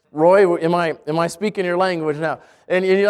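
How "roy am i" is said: ordinary talk, nothing marked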